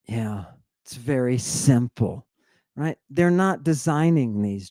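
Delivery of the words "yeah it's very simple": ordinary talk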